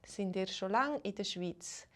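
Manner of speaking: ordinary talk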